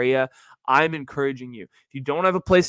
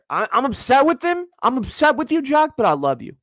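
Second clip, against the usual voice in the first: mock scolding; 9 semitones higher, 4 dB louder than usual.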